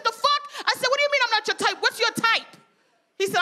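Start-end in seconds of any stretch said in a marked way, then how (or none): 0.0-0.5 high-pitched, incredulous comeback; indignant disbelief. Retold or imitated. Woman retorting to friend who rejected her, indignant disbelief. 0.9-2.6 high-pitched, affronted questioning; indignant demand. Retold or imitated. Woman challenging friend who says she's not his type, indignant demand. Retelling her outraged reply to friend.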